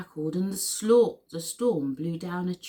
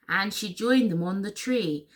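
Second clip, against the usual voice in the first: ordinary delivery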